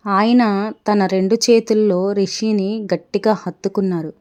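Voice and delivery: ordinary delivery, nothing marked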